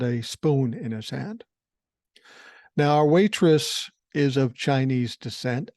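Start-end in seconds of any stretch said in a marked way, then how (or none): none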